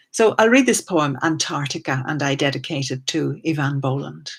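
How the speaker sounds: ordinary talk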